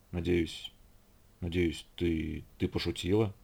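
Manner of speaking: low, soft, halting stammer; hushed, faltering disbelief; stammering mutter. A narrator's reading. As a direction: murmur slowly, unable to believe it. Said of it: "заикаясь, пробормотал наконец Брэд".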